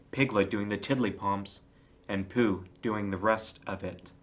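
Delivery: plain reading voice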